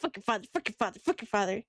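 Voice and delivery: fast, clipped crab chant; snappy clicking crab. Retold or imitated. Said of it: "the crab said".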